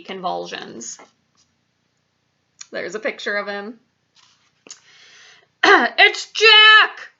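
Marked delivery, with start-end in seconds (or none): 5.6-7.1 high, loud terrified bellow. A narrator's reading. Giant sees Jack in his nightmare, terrified bellow. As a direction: bellow it in alarm. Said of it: "bellowed the flesh lump eater".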